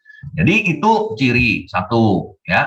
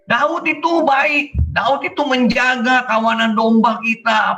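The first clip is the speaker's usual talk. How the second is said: reporting servants; 8 semitones higher than usual. Acted. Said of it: "ini pegawai-pegawainya waktu lapor ke Abigail".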